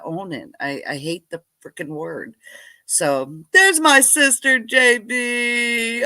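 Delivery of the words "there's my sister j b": loud, high happy call; raised, gleeful greeting; delighted shout-out. Real, not acted. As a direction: call out proudly to a dear friend. Spotting a friend arriving in chat.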